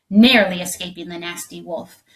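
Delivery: plain reading voice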